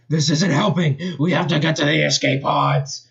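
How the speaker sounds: villain yelling in panic; yelled. Acted. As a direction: Eggman yelling to flee, put-on voice. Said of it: "and yelled".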